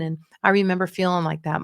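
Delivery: ordinary talk, nothing marked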